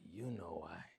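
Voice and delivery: soft, low, knowing reply; lightly acted ominous murmur; hushed retold answer. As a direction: imitate the uncle, dropping very quiet. Dying uncle's ominous answer retold.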